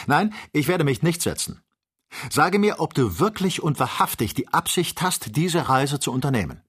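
hoch und laut, fordernd; empörte Weigerung. A narrator's reading. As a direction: stur und aufgebracht Antwort verlangen. Kennedy weigert sich empört. (höher und lauter empört)

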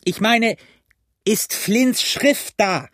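high, slow emphatic yell; drawn-out high-pitched call; impatient shouting. A narrator's reading. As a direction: demand it word by word. Blind man keeps yelling up impatiently.

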